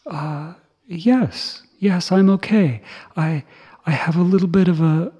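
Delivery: voicing Sariputta. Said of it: "the Arahant Sariputta said to him".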